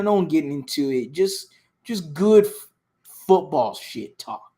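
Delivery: usual speaking voice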